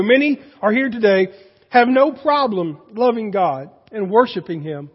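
usual speaking voice

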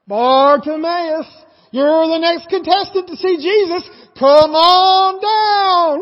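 slow, drawn-out, sung game show announcer; game show host. Retold or imitated. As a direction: call him down like a TV show host. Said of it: "Just like Price is Right".

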